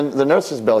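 usual speaking voice

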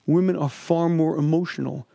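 normal talking voice

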